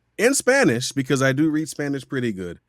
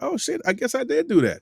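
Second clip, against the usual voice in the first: much higher, amazed discovery; mock startled self; surprised realization. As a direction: catch yourself off guard. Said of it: "and now I'm like".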